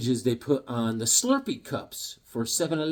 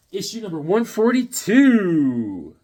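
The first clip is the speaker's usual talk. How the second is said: slow, drawn-out hype announcement, raised pitch; announcer-style callout; 4 semitones higher, 4 dB louder, slower than usual. Real, not acted. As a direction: stretch the number out like a ring announcer. Announcing a comic issue.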